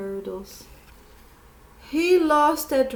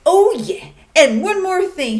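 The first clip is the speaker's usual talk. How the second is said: high, loud angry shout; 8 semitones higher, 9 dB louder, faster than usual. Acted. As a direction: yell it at the judges in a rage. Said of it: "he shouted".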